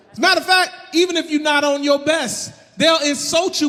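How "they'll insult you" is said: ordinary delivery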